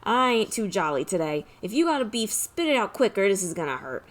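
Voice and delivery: put-on gruff Italian mobster accent; lightly voiced Italian wise guy. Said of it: "My Italian accent isn't great".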